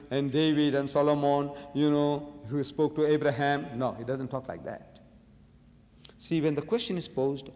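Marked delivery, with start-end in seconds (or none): none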